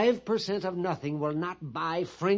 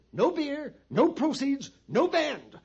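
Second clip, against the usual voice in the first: high-pitched, each "no" punched; three parallel beats, "no" raised high each time; emphatic conclusion; 8 semitones higher than usual. Acted. Pliny concluding his argument to the captain, emphatic conclusion.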